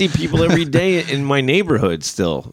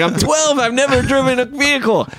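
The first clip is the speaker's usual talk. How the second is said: put-on panicked kid; 12 semitones higher than usual. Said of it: "And I'm like".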